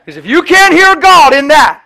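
loud, high fervent preaching; shouted sermon climax; preaching at full force. Real, not acted. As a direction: drive the point home to the congregation. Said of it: "preaching at full force".

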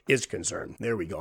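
dry, fading voice running out; voice giving out. Real, not acted. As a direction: let the voice dry up and give out. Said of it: "I'm losing my voice here".

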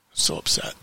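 breathy whispered frustration; hushed upset whisper. Real, not acted. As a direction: whisper it, defeated.

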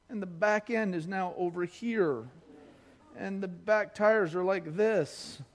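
ordinary delivery

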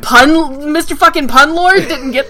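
high, loud mock disbelief; incredulous. Real, not acted. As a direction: tease them for missing the joke. Teasing friend who missed pun.

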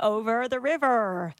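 "over the river" slowly, hard R's held; rhotic R's. Shown as a demonstration. Co-host contrasting with the Australian way, heavy R's. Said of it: "As opposed to".

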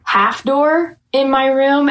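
normal talking voice

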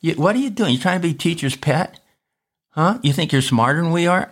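high, taunting schoolkid jeers; high-pitched playground taunt; mocking classmates. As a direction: needle him like a bully in class. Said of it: "I got a little persecution for that".

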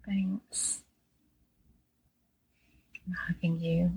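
breathy tender whisper of thanks; barely voiced, hugging them with words; soft whisper. Real, not acted. Guest whispers thanks to the host, soft whisper.

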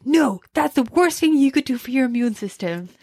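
put-on emphatic American accent; American accent, Dwight. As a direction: declare it firmly in an American accent. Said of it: "I put an American accent on".

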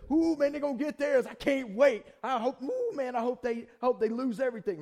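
loud, high gloating; hammed-up vindictive glee; gleeful revenge. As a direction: relish imagining the enemy's downfall. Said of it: "It feels good to".

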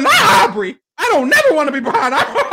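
co-host mimics Michelle's horror at trailing Aubrey, high and loud; imitating Michelle. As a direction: play Michelle squealing her dread of Aubrey. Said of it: "She said".

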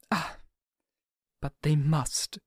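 Mina's voice, soft and grave, stress on they. A narrator's reading. Mina telling Seward the records must be heard, gravely. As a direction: say it quietly, weighing each word. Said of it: "said very gravely".

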